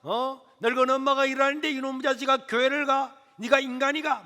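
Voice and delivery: loud, high angry scolding over dinner; mother threatening her son at dinner, loud and angry. Retold or imitated. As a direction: threaten him like a furious mother. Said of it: "막 하고 있다가".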